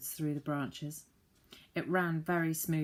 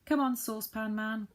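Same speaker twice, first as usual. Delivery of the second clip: calling out; 5 semitones higher, 4 dB louder than usual. A narrator's reading. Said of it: "called Joe".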